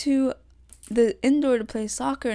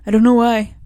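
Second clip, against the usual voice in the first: sudden very loud outburst; real yell; 3 semitones higher, 14 dB louder than usual. Real, not acted. Podcaster frustrated by camera issues, yelling into the mic. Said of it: "didn't mean to yell into this".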